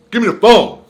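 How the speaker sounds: loud, high demand. Retold or imitated. Telling the case manager to hand over the phone, loud. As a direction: bark it out to get the phone.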